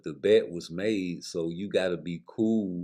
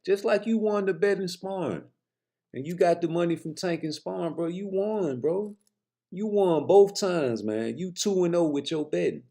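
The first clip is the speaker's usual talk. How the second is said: higher, teasing, rubbing it in; mocking taunt; 8 semitones higher than usual. Real, not acted. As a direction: needle him about winning, bro. Taunting Bill about won bets.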